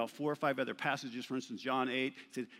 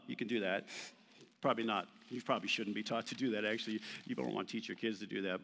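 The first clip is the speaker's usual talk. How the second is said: normal talking voice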